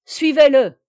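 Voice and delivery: loud, high command; shouted order. A narrator's reading. Captain ordering his rowers after the barge, shouted order. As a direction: call it out to the whole crew.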